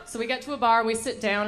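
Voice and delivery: normal talking voice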